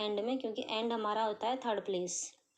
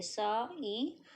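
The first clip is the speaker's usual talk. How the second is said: very slow, each sound drawn out; drawn-out sounding out for students; sounding out slowly; much slower than usual. Shown as a demonstration. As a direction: stretch every vowel while writing. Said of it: "बोल बोल के".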